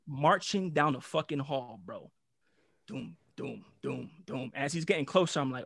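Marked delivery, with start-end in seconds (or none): 2.9-4.5 soft low booming steps; ominous stomping of an approaching principal; footstep sounds. Retold or imitated. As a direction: mimic heavy footsteps coming closer.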